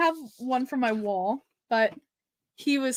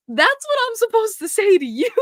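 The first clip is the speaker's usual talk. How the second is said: high, loud fangirl retort; acted giddy disbelief, voice shooting up; excited protest; 11 semitones higher, 6 dB louder than usual. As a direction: squeal it back in mock outrage. Acts out her flabbergasted fan reply.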